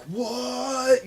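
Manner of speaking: high, stunned what as the fans heard it; shocked what. Retold or imitated. Fans reacting to Kirkman's Glenn spoiler, high shocked what. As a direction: imitate the whole crowd gasping in disbelief.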